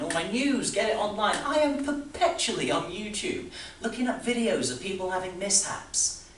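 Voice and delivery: high, loud, escalating confession; agitated rant. Acted. Agitated confession of online habits, escalating.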